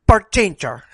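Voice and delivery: put-on "Barchencha" for Virginia in a caricatured Mexican accent; exaggerated Mexican accent on "Barchencha"; mock Mexican pronunciation. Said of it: "or as you might say".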